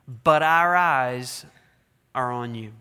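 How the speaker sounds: slow, drawn-out but our eyes; earnest trusting prayer; sincere plea. Acted. Reading the people's prayer.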